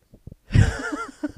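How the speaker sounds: high, loud burst of laughter; shrieking laugh. Real, not acted. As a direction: let the laugh explode. Explosive laugh.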